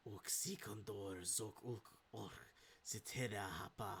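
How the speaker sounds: hushed, low creature speech; soft, low otherworldly utterance; eerie tunnel voice. Acted. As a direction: let the strange tongue drift softly down a tunnel. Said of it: "like, echoing through the tunnel".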